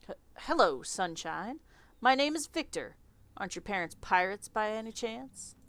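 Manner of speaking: lightly Southern, awkward and halting; Southern accent. Acted. Victor flirting awkwardly with the clerk, light Southern accent. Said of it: "I don't know why he's Southern".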